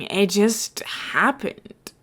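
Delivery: slow, breathless whisper. Acted. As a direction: let it slip out in a slow whisper. Etho shrugs off how it happened.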